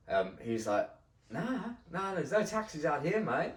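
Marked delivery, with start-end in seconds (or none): 1.3-3.5 high-pitched put-on local voice; imitated pub local. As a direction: play the unhelpful man at the pub.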